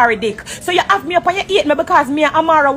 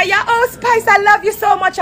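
mock gushing, pitched higher; a sugary fake hello, put on high; 6 semitones higher than usual. Greeting Erica falsely, mock gushing and higher. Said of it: "So when me say".

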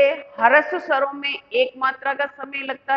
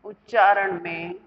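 dictation; 5 semitones lower, 5 dB quieter than usual. Shown as a demonstration. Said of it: "पहले इसकी परिभाषा लिख लो".

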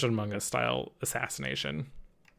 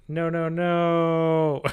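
dismayed no; 5 semitones higher, 7 dB louder, much slower than usual. Real, not acted. Reacting to permaban news.

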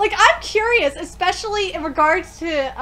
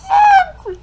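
shocked gasp; 13 semitones higher, 11 dB louder than usual. Real, not acted. Shocked by scene on screen.

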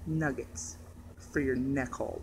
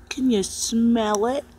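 teasing slow question; 6 semitones higher, slower than usual. Acted. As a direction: stretch it out teasingly. Repeated question, slow and raised.

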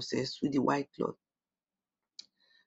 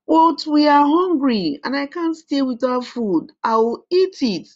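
put-on high, loud whiny child; stubborn hungry boy; 10 semitones higher, 5 dB louder than usual. A narrator's reading. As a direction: insist on eating. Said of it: "refused to leave the food".

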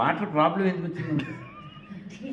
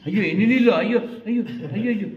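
higher, louder mock dismay at the tears; mock alarm; 4 semitones higher, 4 dB louder than usual. Comforting a crying little girl with mock dismay. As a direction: ham up the alarm over her crying.

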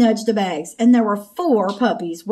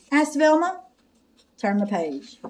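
ordinary delivery

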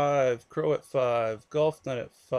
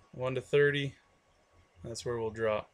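ordinary talk, nothing marked

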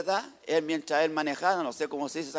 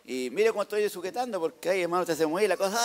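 usual speaking voice

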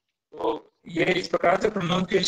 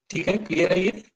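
normal talking voice

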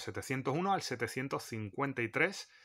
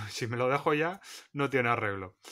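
laughing; 2 semitones higher, 7 dB louder than usual. Real, not acted. Jokes about having forgotten something.

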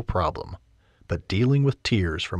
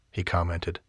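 normal talking voice

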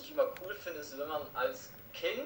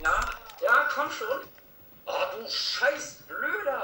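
frustrated yelling; 7 semitones higher, 6 dB louder, slower than usual. Real, not acted. Yelling at an enemy in game.

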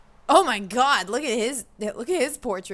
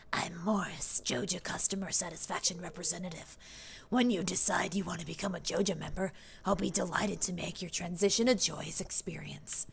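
Morris; 2 semitones lower, 8 dB quieter than usual. Acted. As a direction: purr it quietly like an evil salesman. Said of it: "I need to give him, like, evil voice".

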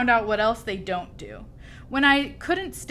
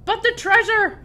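very high, loud protest; distressed captain's cry; hollering; 12 semitones higher, 7 dB louder than usual. A narrator's reading. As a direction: holler in alarm over the storm. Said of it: "hollered Braidbeard".

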